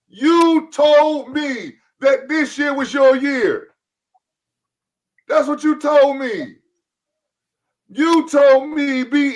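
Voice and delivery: high, slow, drawn-out teasing call to rival fans; hyped-up callout. Real, not acted. Host taunting Cowboy fans on the show, slow, high, hyped callout. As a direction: stretch every word like you're calling the whole fanbase in to get roasted.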